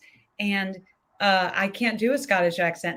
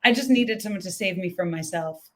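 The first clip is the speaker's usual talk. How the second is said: usual speaking voice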